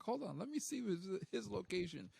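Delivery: high, chirpy robot cat voice; toy robot voice. Retold or imitated. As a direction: beep it out like a little robot toy. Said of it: "he's like".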